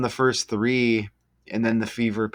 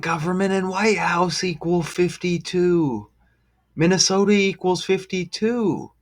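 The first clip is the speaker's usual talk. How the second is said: higher, eager reveal voice; excited; 7 semitones higher than usual. Real, not acted. Vlogger revealing fifty-two number matches to viewers, excited and high. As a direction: build the number coincidences with rising wonder.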